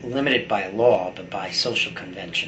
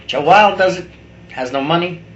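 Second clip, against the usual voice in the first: sudden loud outburst; 7 semitones higher, 10 dB louder than usual. Real, not acted. Ranting about age gaps, a sudden loud burst. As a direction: punch it out loud and high.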